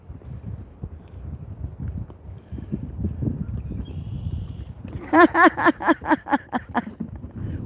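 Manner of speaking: long burst of high laughter; drawn-out giggling fit; real laugh. Real, not acted. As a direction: laugh on and on, helplessly. Laughing at the goats.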